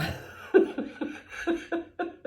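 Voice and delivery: high breathy giggling; can't stop laughing; giggle fit. Real, not acted. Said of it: "Excusez-moi". (fou rire)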